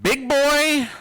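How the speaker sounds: a hint of a parent's sing-song quiz; parental rule phrase. Parents quizzing the kids, lightly mimicked. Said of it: "you are to say".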